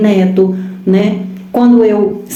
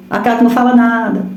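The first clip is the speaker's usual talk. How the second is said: ordinary talk, nothing marked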